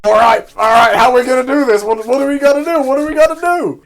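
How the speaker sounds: high, loud eager rush; eager Denji. Retold or imitated. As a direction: pounce on the offer, all excited. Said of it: "Denji is immediately interested".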